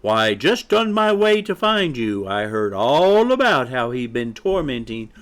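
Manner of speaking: indignant, high-pitched hen lecture; scolding mother hen. Acted. Mother hen warns chick about his friend, indignant. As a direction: warn him grandly, stretching key words.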